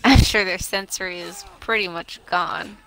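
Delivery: usual speaking voice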